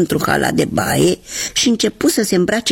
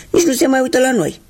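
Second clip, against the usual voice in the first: higher and fast, a hurt complaint; shoe character voice; 9 semitones higher, faster than usual. Acted. Old shoe complaining about the owner, higher and fast. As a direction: rush out the complaint.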